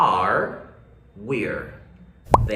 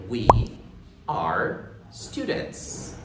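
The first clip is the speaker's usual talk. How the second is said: slow model English; much slower than usual. Shown as a demonstration. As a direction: stretch each word out for learners to repeat. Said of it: "La forma afirmativa sería".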